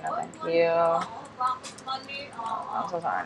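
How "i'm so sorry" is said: slow, higher put-on apology; mock sorry voice. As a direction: stretch it out in a silly squeaky voice.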